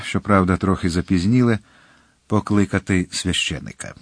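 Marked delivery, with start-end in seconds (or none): none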